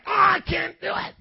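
high, effortful cry of giving up; mock straining weightlifter. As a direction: strain as if the bar is too heavy. Acts giving up under heavy weight.

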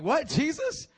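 high, loud indignant denial; offended protest. Acted. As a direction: protest like you're insulted. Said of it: "he got offended".

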